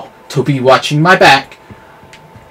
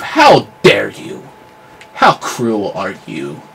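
ordinary delivery

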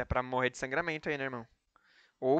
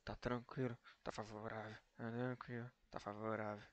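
meme murmured softly; 5 semitones lower, 19 dB quieter than usual. Retold or imitated. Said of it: "tem que mandar o".

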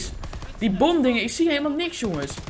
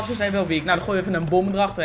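usual speaking voice

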